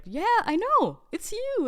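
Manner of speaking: high, insistent "it's you"; like a fan who is sure; excited fan. Retold or imitated. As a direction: fan insisting they recognize her, excitedly. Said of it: "And they were like".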